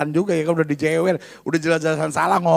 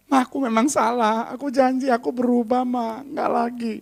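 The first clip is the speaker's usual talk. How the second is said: put-on pleading child; 3 semitones higher than usual. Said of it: "dia bilang gini".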